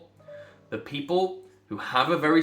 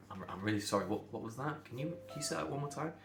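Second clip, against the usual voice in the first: soft, hesitant retold apology; quietly acted-out polite plea; apologetic request; 11 dB quieter, faster than usual. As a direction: politely ask for a repeat, much softer. Retold polite apology over party music.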